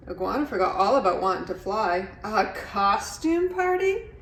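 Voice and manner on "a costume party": much higher, slow delighted rise; thrilled question. A narrator's reading. Iguana reading the party invitation, thrilled high cry. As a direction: gasp with delight. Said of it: "she cried".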